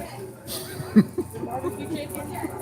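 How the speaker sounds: high, soft laughter; amused laugh. Real, not acted. Speaker laughing at his own handler joke, amused.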